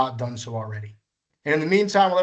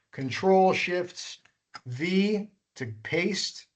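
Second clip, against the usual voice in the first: slow dictation; much slower than usual. Shown as a demonstration. Dictating the key combination.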